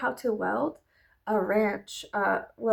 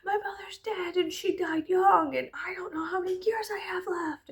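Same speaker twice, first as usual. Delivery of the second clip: high whispered lament; mock anguished whisper; 8 semitones higher than usual. To her husband, a higher whispered lament. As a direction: whisper it like a breakdown. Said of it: "I was like".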